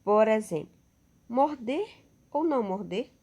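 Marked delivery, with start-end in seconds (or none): 1.3-3.1 "Morder" lifted high, "ou não morder?" rising as a question; teacher demonstrating a question; question tune. Shown as a demonstration. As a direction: ponder aloud, rising at the end. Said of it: "Olha aí, uma pergunta".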